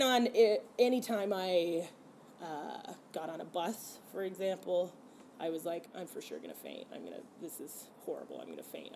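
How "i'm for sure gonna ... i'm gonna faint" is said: much lower, much softer, fearful murmur; anxious panic. Retold or imitated. Riding the bus, convinced she will faint, in a soft low fearful murmur. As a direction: hush it down like a frightened inner voice.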